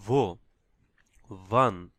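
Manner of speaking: "ভো, ওয়ান" each starting with English v; W pronounced as v. Shown as a demonstration. Teacher models German W like v in very, v pronunciation. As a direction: say "ভো", pause, "ওয়ান" with a v. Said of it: "ওইভাবে উচ্চারণ করতে হবে".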